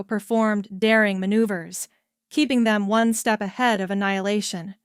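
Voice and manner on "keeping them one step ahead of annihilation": plain reading voice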